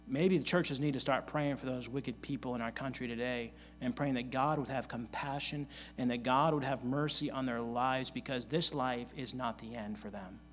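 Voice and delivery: usual speaking voice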